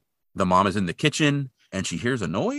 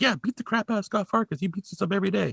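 high-pitched imitated kid; egging on; 11 semitones higher, 3 dB quieter than usual. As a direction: egg him on in a mock child's voice. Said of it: "just egging him on".

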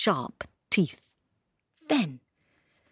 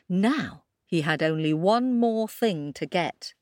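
ordinary delivery, nothing marked

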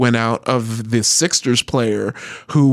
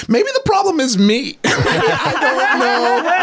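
laughing; 13 semitones higher, slower than usual. Retold or imitated. Self-quote, laughing at own dating.

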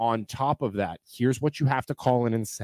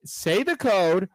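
ordinary delivery

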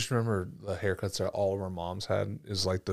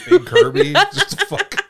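squealing with laughter; laughing it out high and loud; 12 dB louder, slower than usual. Real, not acted. Mocking nickname amid laughter.

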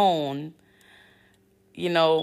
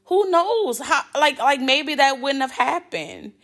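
excited; 8 semitones higher than usual. Real, not acted. Still elated pitch.

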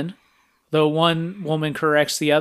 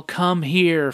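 ordinary talk

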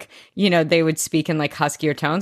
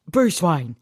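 Michael Caine impression. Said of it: "he's like".

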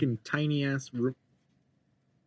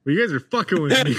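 ordinary talk, nothing marked

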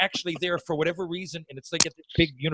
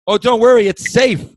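loud, raised-pitch reassurance shouted upward; imitating a boss hollering from the ground; calling up; 8 semitones higher, 7 dB louder than usual. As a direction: call up to someone high overhead. Bob calls up from below.

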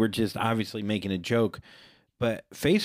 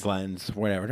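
usual speaking voice

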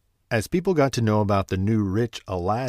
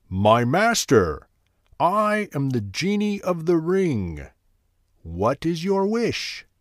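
slow, higher booming genie; 6 semitones higher, slower than usual. Acted. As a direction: speak slowly like a mystical spirit. Genie of the ring appears.

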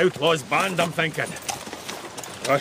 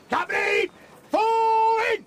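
shout; slower than usual. Acted. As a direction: yell the order to the whole troop. Calling out orders across troop.

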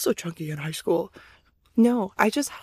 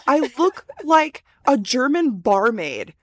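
usual speaking voice

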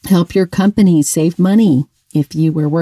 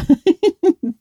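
high giggle; 12 semitones higher than usual. Real, not acted. Laughs after mock forbidding.

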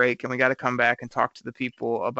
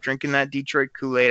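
ordinary delivery

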